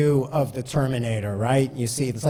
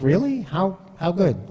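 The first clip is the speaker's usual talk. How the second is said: high, eager "Really?" that eases down on "How, how good?"; retold disbelief pitched well up; his younger self hearing his score, surprised; mock surprise; 5 semitones higher than usual. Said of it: "I'm like".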